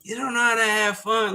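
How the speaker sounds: high mock complaint; high whiny scold; upset girlfriend. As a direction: imitate his annoyed date scolding. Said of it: "she's just like".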